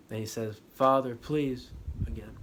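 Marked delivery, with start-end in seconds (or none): 0.8-1.7 louder, pained plea; pleading cry. Retold or imitated. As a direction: cry out begging for help. Luke begs again.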